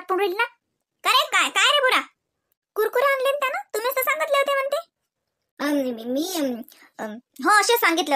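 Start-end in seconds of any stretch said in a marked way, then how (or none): 1.0-4.9 higher put-on voice mimicking her; a mocking higher voice. Quoting the girl's voice. 5.6-7.2 soft, faltering stammer, caught in a lie; quiet guilty stammer; hesitant mumble. Acted.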